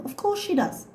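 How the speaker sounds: usual speaking voice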